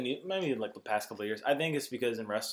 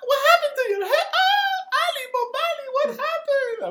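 high loud wailing Annie; Jar Jar impression; 11 dB louder than usual. Retelling Robot Chicken Jar Jar, high loud wail. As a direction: wail it like a cartoon alien. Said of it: "And then he's like".

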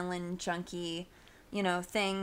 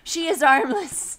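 loud, slow and higher, deadpan; loud slow punchline; 7 semitones higher, 11 dB louder than usual. Real, not acted. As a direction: hammer home the punchline. Punchline about Venus de Milo.

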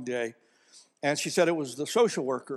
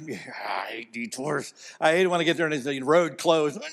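mock grumbling; 2 semitones lower than usual. Preacher voicing a congregant who hates detours, grumbling. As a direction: play the fed-up driver.

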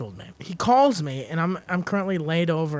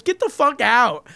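loud, slow, raised mock order; acted angry dismissal; 6 semitones higher, 5 dB louder, slower than usual. Manager to fired employee showing up in uniform, loud drawn-out dismissal. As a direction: imitate a boss throwing someone out.

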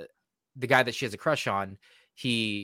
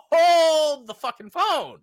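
much higher, louder, slow drawn-out outrage; shocked disbelief; 7 dB louder, much slower than usual. Retold or imitated. Viewer stunned by the creepy twist, slow high shout. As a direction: stretch it like a cartoon double take. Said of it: "And I'm like".

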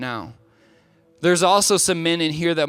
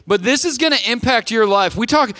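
excited hype; 5 semitones higher, 4 dB louder than usual. Real, not acted. Hyping the upcoming rally.

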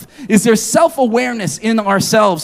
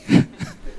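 self-deprecating laugh; 4 dB quieter than usual. Real, not acted. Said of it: "laugh after confession".

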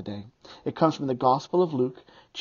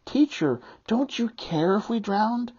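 angry desperate; 7 semitones higher than usual. Acted. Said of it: "a more angry and desperate response".

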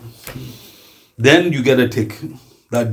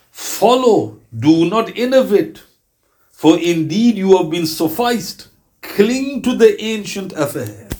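slow, higher, deliberate words; solemn slow translation; 5 semitones higher, slower than usual. Shown as a demonstration. Preacher translating the command for his listeners with solemn weight. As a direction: lay each command down slowly.

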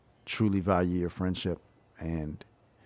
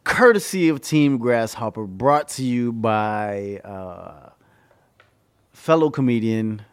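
airport announcement; 3 semitones higher, 6 dB louder, slower than usual. Pretend. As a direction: announce the sponsor like a PA. Echoes the announcement.

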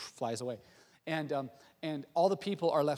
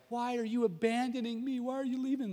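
put-on high-pitched pleading little child; whiny, hammed-up plea at being left; mock child's crying; 7 semitones higher than usual. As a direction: imitate a heartbroken kid's wail. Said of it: "they're crying, and they're freaking out".

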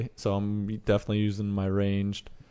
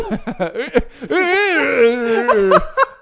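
high laughing zombie wail; zombie noise; 7 dB louder than usual. Pretend. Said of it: "Do the noise again".

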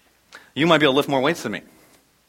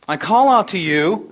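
louder, higher, slow plea; calling out; 5 semitones higher, 7 dB louder, slower than usual. Acted. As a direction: call out for help, stretching it. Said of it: "calling out".

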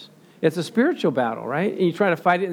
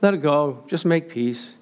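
calm coworker voice; 5 semitones lower than usual. Retold or imitated. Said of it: "You know what he says".

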